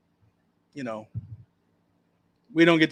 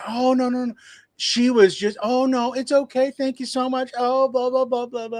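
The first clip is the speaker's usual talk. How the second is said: high, gushing imitation of a customer; pitched-up sugary thanks; mock sweet customer; 9 semitones higher than usual. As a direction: coo it like a suddenly friendly customer. Customer now smoothing things over.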